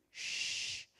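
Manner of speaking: "Ch" as a long voiceless hush; isolated phoneme. Shown as a demonstration. Teacher repeating the sound with its sign, "Ch" held as a hush. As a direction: push the lips forward and sustain "Ch" like shushing. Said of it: "ça accentue la projection des lèvres vers l'avant".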